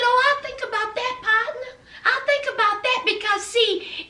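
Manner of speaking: high-pitched, taunting retort; taunting warning. Retold or imitated. Elena warning Mia not to testify, taunting.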